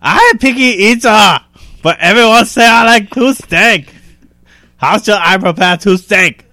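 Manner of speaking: loud, high, hammed-up broken English. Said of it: "Broken English".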